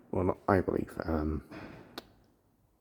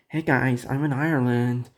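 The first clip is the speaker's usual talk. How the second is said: light American accent impression; playful American tourist accent; 4 semitones higher than usual. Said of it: "Sorry about my American accent impression".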